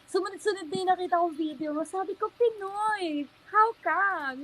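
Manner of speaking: gushing surprise, voice climbing high; excited disbelief. Real, not acted. Excited retelling of discovering her.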